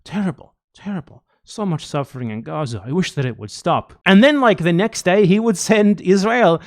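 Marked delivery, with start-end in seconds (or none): 0.0-3.9 soft, hushed mock sympathy; a quiet imitation of Biden's hollow grief. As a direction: whisper out fake sorrow like a politician. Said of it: "Biden would go on the podium and say".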